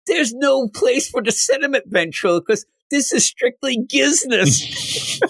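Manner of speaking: high, slow, cold puppet voice; dummy Scarface voice. Retold or imitated. Scarface coldly tells the ventriloquist that it is business. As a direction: lay down the law, slowly stretching gizness.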